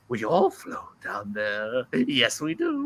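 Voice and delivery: slow, creepy clown voice; Pennywise. Retold or imitated. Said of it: "when I do Pennywise".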